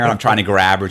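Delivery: ordinary talk, nothing marked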